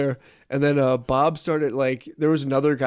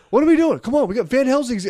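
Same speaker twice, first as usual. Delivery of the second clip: boss scolding; 11 semitones higher, 5 dB louder than usual. Retold or imitated. Boss scolding idle workers.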